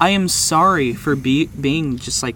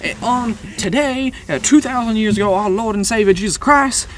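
preacher voice; 6 semitones higher, 3 dB louder than usual. Pretend. Mock preacher quoting scripture.

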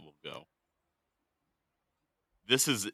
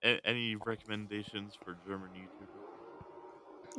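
soft, hard-to-hear question; too quiet; 5 dB quieter than usual. Real, not acted. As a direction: ask it softly, barely reaching the mic. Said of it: "when you're that quiet".

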